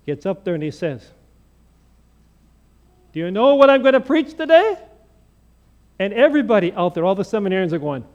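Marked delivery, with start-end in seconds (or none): 3.1-4.8 higher, louder question hurled out; loud challenge. Retold or imitated. Seminarian asking the seminarians again, loud and bold. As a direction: call it out to the whole room.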